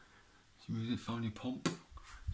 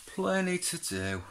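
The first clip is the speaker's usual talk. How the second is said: "Plenty to do" high and slowly stretched; high drawn-out repeat; 11 semitones higher, slower than usual. Real, not acted. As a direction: sing "Plenty to do" out high and slow.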